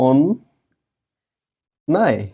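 অন্যায় very slowly split as ওন, then নায়; correct pronunciation. Shown as a demonstration. Teacher dictating spelling, অন্যায় very slowly by syllable. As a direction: sound out অন্যায় in two long syllables. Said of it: "বলতে হবে".

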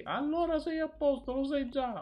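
high mock mother voice, still calling. Son imitating his mother replying to Sara. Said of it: "ma urla".

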